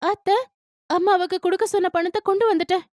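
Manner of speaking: Lalitha's voice. A narrator's reading.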